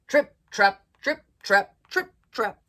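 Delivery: loud, punchy trip-trap steps; hoofbeats. A narrator's reading. Storyteller sounding the second goat's steps, loud and rhythmic. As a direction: stamp each word out.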